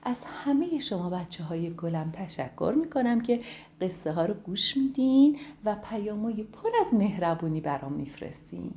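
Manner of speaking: ordinary delivery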